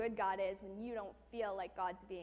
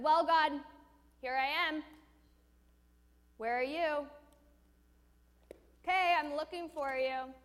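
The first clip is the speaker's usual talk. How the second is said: exasperated, raised voice demanding answers; angry at God; 4 semitones higher, 5 dB louder than usual. Retold or imitated. Young student shouting at God in anger. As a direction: call out to God in frustration. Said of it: "just angry".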